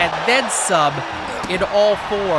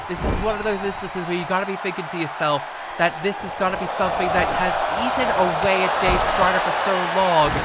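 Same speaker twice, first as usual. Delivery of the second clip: ordinary talk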